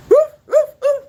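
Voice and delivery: high, loud imitated woofs; dog barking. Streamer doing a requested dog impression, high loud barks. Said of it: "can you do a dog impression?".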